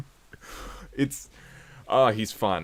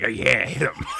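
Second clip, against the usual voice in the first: gleeful cheer through a laugh; mock glee. Monkey wanting him run over, gleeful laughing mock cheer. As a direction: egg it on with a grin.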